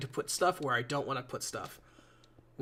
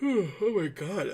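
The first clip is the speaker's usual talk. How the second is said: drawn-out, higher words through a yawn; 4 semitones higher, much slower than usual. Real, not acted. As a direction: let a slow yawn stretch the words. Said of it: "I'm yawning so much".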